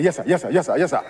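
lightly voiced driver, just humoring the boss; driver's offhand yes sir. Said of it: "I said".

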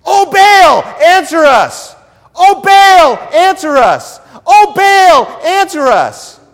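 high, loud repeated cry; frantic shouting. Acted. Priests of Baal begging their god, frantic shout. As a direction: shout it over and over like desperate priests. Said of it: "Talk about repetition".

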